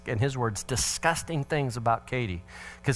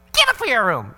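raised, louder command; angry order; 9 semitones higher, 7 dB louder than usual. Retold or imitated. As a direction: send him off with a snap. Father orders son again.

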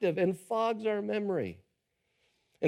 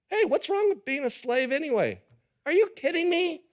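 higher, incredulous griping; mock indignation; 8 semitones higher than usual. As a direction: rise up in fake outrage. Voicing the Israelites' complaint.

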